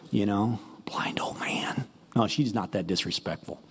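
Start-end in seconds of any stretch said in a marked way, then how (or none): 0.1-1.8 breathy whispered jab; whispered aside. Retold or imitated. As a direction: whisper it behind his back. Mocking aside about blind Isaac.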